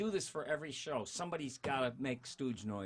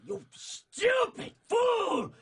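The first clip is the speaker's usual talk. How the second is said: high, loud, slow screaming chihuahua; Ren's furious shout; 11 dB louder, much slower than usual. Acted. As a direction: scream it like a mad little dog. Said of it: "it was like that".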